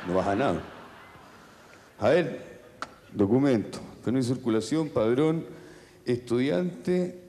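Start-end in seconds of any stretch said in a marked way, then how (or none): 0.1-5.5 low-voiced officer, unhurried; low, lightly acted policeman; traffic cop. Policeman asking a driver for papers, low and official.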